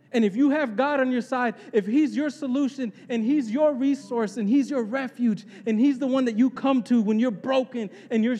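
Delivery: high, driving preacher's voice; impassioned preaching. Real, not acted. Preacher reminding the congregation God is with them, impassioned. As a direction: preach it with rising force, stretching key phrases.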